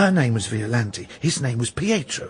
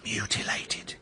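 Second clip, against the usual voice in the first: whispered, much softer; grim whisper; 4 semitones lower, 12 dB quieter than usual. Acted. Onlooker at the church tells the stranger about the bodies, whispering. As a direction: breathe the word to a stranger.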